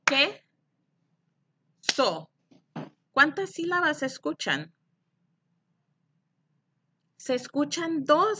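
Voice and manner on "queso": "Queso" split as que, long pause, so; rising first beat, falling second in "Queso"; clapped syllables. Shown as a demonstration. Teacher claps for pupils to count, "Queso" in two beats. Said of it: "Aplaudan conmigo".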